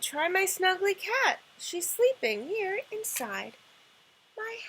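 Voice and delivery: a hint of a sing-song witch; lightly voiced kindly witch. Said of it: "The witch said".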